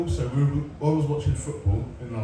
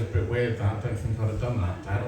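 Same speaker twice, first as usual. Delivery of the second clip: put-on a mild sarcastic teen voice, lower and softer; lightly mock the dad with dry sarcasm; son's smug put-down, understated; sarcastic kid voice; 6 semitones lower, 4 dB quieter than usual. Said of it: "puts on his little sarcastic voice".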